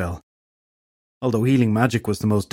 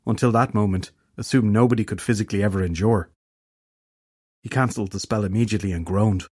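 ordinary delivery

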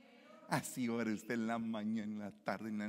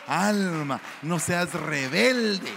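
loud, slow command to the soul; stern exhortation; 4 semitones higher, 9 dB louder, much slower than usual. Acted. Preacher exhorting his own soul loudly and slowly. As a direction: order your soul to obey.

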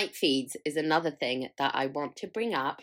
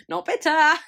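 high, loud mock denial; sarcastic; 9 semitones higher, 9 dB louder than usual. Real, not acted. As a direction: insist on it, obviously bitter. Sarcastic self-mocking remark.

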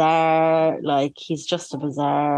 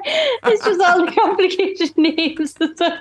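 high, breathless giggling through the words; can barely get the words out for laughing; crying with laughter; 11 semitones higher, 4 dB louder than usual. Real, not acted. Co-host helplessly laughing at the old names, crying with laughter. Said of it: "sorry, I'm crying".

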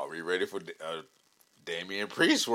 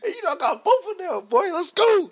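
excited; 6 dB louder than usual. Real, not acted. Excited hype shout.

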